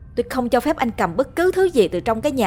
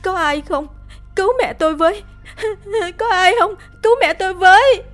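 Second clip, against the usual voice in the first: very high, loud, sobbing cries; crying for help; 13 semitones higher, 6 dB louder, faster than usual. Acted. Daughter holding bleeding mother screams for neighbours' help. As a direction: scream for help through tears. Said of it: "hét ầm lên".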